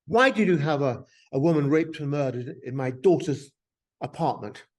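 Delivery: mock outraged complaint, hitting the opening hard; indignant. Author retelling a reader's complaint about a murder scene, indignant. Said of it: "writing to me very indignantly saying".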